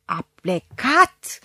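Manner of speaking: a slow, drawn-out furious shout; shouted through teeth. A narrator's reading. Mother shouting at daughter about the maid, slow furious shout. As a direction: spit it out slowly through clenched teeth. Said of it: "a strigat ea, scrâșnind din dinți".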